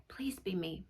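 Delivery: whispered wish, barely a breath; hopeful whisper. Acted. Student praying to be picked, whispering. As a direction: whisper it like a secret plea. Child hoping name is drawn.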